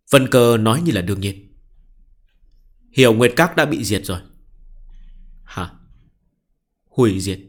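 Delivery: steady audiobook reading; story narration. A narrator's reading. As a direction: read the chapter aloud evenly. Reads novel chapter aloud.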